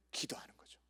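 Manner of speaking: near-whisper, very soft; hushed answer. Real, not acted. Preacher tells what to do instead of fighting, hushed.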